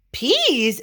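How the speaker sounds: high, loud surprised question; exclaiming. A narrator's reading. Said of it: "exclaimed Scipito".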